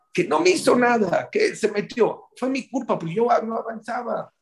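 high, plaintive retelling; imitated tearful outburst. Woman blames herself after the call, imitated tearfulness. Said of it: "empieza a llorar como niña chiquita".

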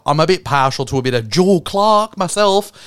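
mock English accent, lightly done; crap English accent. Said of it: "crap English accent".

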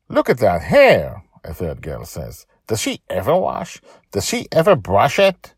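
mock her hair; mean girl. Girl taunts Joy.

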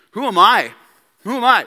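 defensive. Retold or imitated. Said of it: "would you be tempted to say this".

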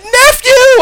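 high, loud call of nephew; auntie calling out. Retold or imitated. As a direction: call out to him across the store, surprised. Auntie calls out to him.

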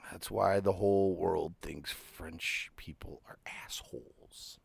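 snide unvoiced whisper; whispered aside. Real, not acted. Joking aside to co-host about French people, whispered. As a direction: lean in and whisper the jab.

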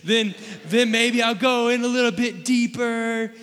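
high, loud, drawn-out goofy voice; hammed-up voice of someone venturing further; silly put-on voice. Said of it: "I don't know why I'm using that voice".

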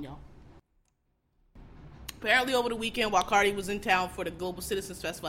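ordinary talk, nothing marked

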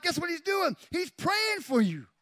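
high-pitched, emphatic preaching cry; full-force preaching. Real, not acted. Preacher telling congregation someone is praying, full-force preaching.

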